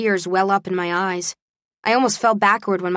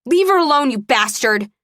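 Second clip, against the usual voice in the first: much higher, louder angry scream; furious shout; 5 semitones higher, 4 dB louder than usual. Acted. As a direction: scream at him to let go of her. America attacking Travis.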